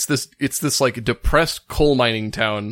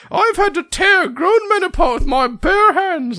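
much higher, slow, hammed-up banker boast; mock tough banker; 4 dB louder than usual. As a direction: boast like a puffed-up banker in a squeaky voice. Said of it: "And he's like".

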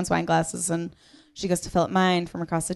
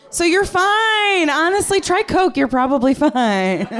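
mock cheerful reassurance; 11 semitones higher, 6 dB louder than usual.

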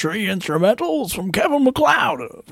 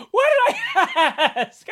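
laughing; 14 semitones higher, much slower than usual. Real, not acted. Laughing at own flubs.

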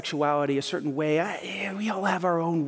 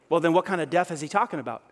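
ordinary delivery

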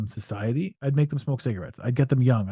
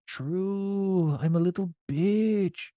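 mock defeated self; 6 semitones higher, 4 dB quieter, much slower than usual. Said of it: "And I'm like".

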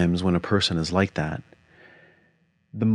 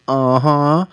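imitating wife; 8 semitones higher, 7 dB louder than usual. Voicing wife's reply.

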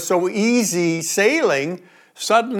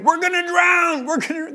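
much higher, panicked imitation; panicking; 11 semitones higher than usual. Disciples panicking in the boat, high panicked cry. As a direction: squeal it like frightened men in a sinking boat. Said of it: "they're panicking and saying".

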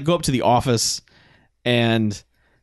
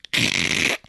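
rough, crackly crunching noise; short crackle in the throat; imitated crunch; 7 semitones lower, 3 dB quieter than usual. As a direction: make the noise of a mangled leg moving. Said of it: "tries to move it and just goes".

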